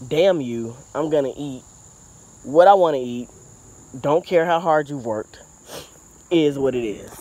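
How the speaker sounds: loud, defiant mock deer voice. Gardener voicing the deer that stomped at her, mock defiance. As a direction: talk back loudly as the stubborn deer. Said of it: "This deer stomped at me. Was like".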